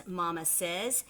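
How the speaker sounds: ordinary delivery, nothing marked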